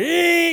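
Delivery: high, drawn-out toy engine whine; pretend car noise. Kid playing in a pretend car, imitated engine noise. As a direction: imitate a kid's revving car. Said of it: "little pretend car and you're like".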